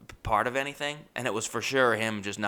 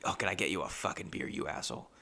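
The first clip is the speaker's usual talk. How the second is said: low, quick, grudging undertone; resentful grumble; 5 semitones lower, 10 dB quieter, faster than usual. Retold or imitated. Customer asked to fetch the tattooist a beer, grumbling resentfully. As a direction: grumble it softly under your breath.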